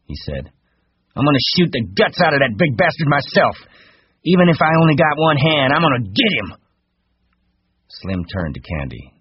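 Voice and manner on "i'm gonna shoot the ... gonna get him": high, loud, furious vow; vengeful rage. A narrator's reading. Curly vows to kill Lenny himself, raging. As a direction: swear revenge in a raised voice. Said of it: "Curly's face reddened".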